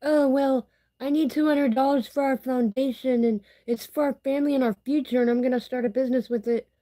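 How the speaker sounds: high, sing-song mocking voice; quoting her ex's message in a mocking high voice. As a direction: mimic him with a high, whiny sneer. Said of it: "he messaged me on Messenger and said".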